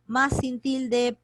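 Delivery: más sin tilde, dicho muy despacio y marcado; slow emphasis. Shown as a demonstration. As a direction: recalca cada palabra: más, sin, tilde. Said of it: "este más sin tilde es el reemplazo de pero". (énfasis lento de profesora)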